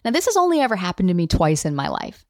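ordinary talk

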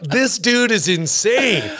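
high, slow, stretched-out astonishment; mock disbelief. Soldiers reacting to the comic's target shirt, mock disbelief. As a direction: marvel at a madman, drawing it out.

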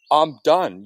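a loud, final I'm done; flat final declaration. Retold or imitated. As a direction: slam the words down, finished. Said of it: "that's just a".

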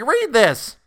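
very high, loud demand; desperate cry. A narrator's reading. Boy pushes his papers at the colonel, very high and loud. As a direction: thrust it at him, voice high and loud. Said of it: "Benjamin cried desperately".

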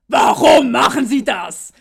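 a loud, slow mock yell; imitated furious shout. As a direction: yell it slowly like an outraged clerk. Wütender Zollbeamter, nachgespielt. (laut und langsam nachgespielter Schrei)

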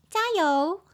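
high claw-machine voice; claw-machine cheer. Shown as a demonstration. Teacher tries another version of her cheer, high-pitched. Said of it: "很像夹娃娃机".

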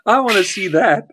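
high, just out of a laughing fit; amused eagerness. Real, not acted. Still amused after long laughing fit.

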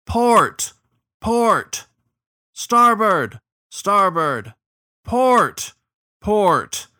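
repeated high, loud orders, each echoed; call-and-response shouting. A narrator's reading. Captain and crew trading steering orders, shouted. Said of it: "droning antiphon".

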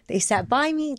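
words catching as feeling breaks through; emotional. Real, not acted. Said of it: "Oh, I'm gonna get all emotional".

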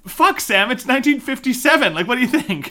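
high, loud mock exasperation; exasperated retort. Viewer tells Sam off about nineteen fifty-seven, exasperated.

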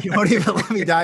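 loud, fast mock outrage; angry retold cry. Man laughing while replaying his shout at God. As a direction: fling it back at God, laughing. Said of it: "I was so angry".

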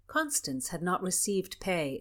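ordinary delivery, nothing marked

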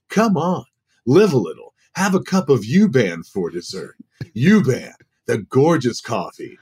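bright hammed-up coffee commercial read; voiceover ad read. Shown as a demonstration. Auditioning for the agency with a mock coffee commercial. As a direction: sell the coffee like a radio ad. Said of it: "Do you do any voiceover work".

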